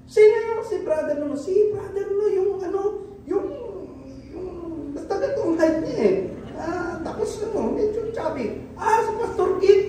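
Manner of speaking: high chatty voice groping for a name; high-pitched mock gossip, stalling on yung ano; mock congregant gossip. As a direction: play a forgetful churchgoer hunting for the word. Congregant voices describing brother.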